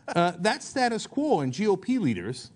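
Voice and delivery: usual speaking voice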